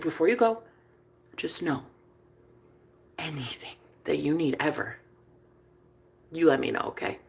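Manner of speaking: put-on soft, low, sincere boyfriend; hushed and caring, with pauses; tender reassurance. Voices boyfriend's tender promise.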